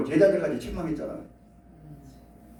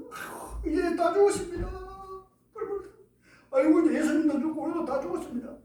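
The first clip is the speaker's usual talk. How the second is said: higher pitched, softer panicked wail of doomed disciples; half-acted lament, raised pitch and hushed; mock panic; 11 semitones higher, 6 dB quieter than usual. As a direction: play the frightened disciples crying we are all dead.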